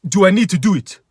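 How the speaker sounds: dim customer voice. Retold or imitated. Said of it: "He says".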